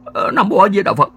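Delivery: put-on high, loud, panicked old man begging a ghost; old grandfather praying in terror to a ghost, high and loud; terrified pleading. A narrator's reading. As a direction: beg for mercy in a high, frantic old voice. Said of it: "nhắm tịt mắt ra van vái".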